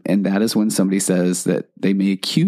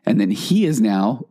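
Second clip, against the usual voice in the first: ordinary delivery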